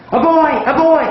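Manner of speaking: high excited shouted yes; eager shout. Retold or imitated. Student shouting the answer to the teacher, high and excited. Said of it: "instantly shout".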